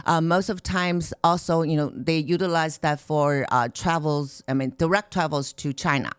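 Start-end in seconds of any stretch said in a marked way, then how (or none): none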